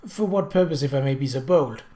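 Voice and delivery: usual speaking voice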